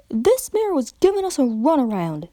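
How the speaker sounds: lightly voiced country pony; a hint of a stubborn farm mare. A narrator's reading. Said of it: "said the orange pony".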